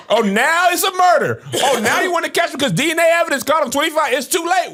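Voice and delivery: high, fast, laughing sarcasm; sarcastic mockery. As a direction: scoff through a laugh. Sarcastic mock of double standard.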